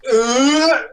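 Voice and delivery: loud, high straining grunt; effortful grunt. Acted. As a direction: heave a huge weight with a grunt. Giant heaving a boulder, imitated.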